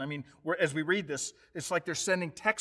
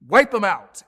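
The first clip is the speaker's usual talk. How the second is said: building louder each "because", ending in a shout; rising hateful rant; 6 semitones higher, 9 dB louder than usual. Acted. Haman urging the king to wipe them out, loud. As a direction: stoke the crowd up to "wipe 'em out".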